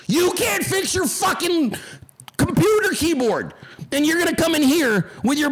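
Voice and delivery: high, incredulous angry rant; scolding with pitch shooting up; exasperated outburst. Real, not acted. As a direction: raise the pitch in disbelief and berate them. Streamer berates commenter over broken keyboard.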